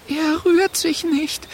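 high, slow shaky voice; trembling voice. A narrator's reading. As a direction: let the voice tremble. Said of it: "sagt Lulu mit zitternder Stimme".

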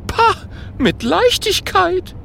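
very high, boastful ghost voice; cocky relish. A narrator's reading. Ghost sure it can swap the wands, cocky. Ghost brags, rolling its eyes.

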